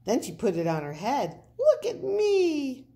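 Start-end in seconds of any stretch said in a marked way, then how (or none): 1.6-2.9 high, loud, delighted cry; showing off. Acted. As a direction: show off to the crowd. Proud little pipsqueak shows off.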